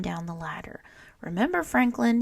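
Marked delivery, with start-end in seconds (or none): 1.2-2.2 louder call back; called out reminder. A narrator's reading. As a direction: call it over your shoulder. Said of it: "he called".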